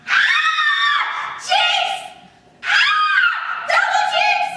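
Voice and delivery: piercing high shrieks of shock; shocked screams. Acted. Startled girl shrieking jeez over and over, very high and loud. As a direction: shriek like you just got startled.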